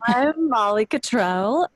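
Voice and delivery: ordinary talk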